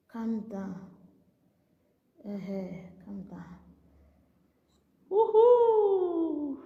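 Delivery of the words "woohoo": much higher, a gleeful whoop; high excited woohoo; cheering whoop. Real, not acted. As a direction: whoop with glee.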